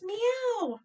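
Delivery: high, drawn-out meow; playful cat noise. Pretend. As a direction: meow like a cat for small children. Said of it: "making fun animal noises".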